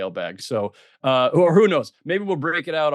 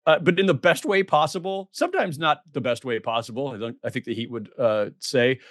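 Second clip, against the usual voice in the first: ordinary delivery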